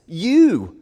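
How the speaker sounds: "You" hit loud and high; stress on You. Shown as a demonstration. Preacher showing the emphatic "You", stressed. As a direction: punch "You" as the emphatic word. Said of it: "is in the emphatic place in the sentence".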